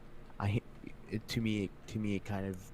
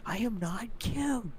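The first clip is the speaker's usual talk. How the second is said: high, loud, drawn-out imitated denial; mock protest; 6 dB louder, slower than usual. Girlfriend protesting to her partner's compliment, mock indignant. Mimics girlfriend denying she's cute.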